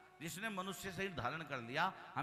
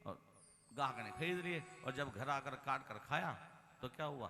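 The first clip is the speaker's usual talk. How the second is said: ordinary delivery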